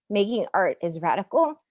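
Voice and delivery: normal talking voice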